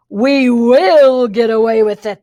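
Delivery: loud, slow villain twins' yell, pitched oddly; yelled in unison. Acted. Twins shouting back at the girl, slow and loud. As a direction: hammer back the boast as two villains. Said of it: "they yelled in unison".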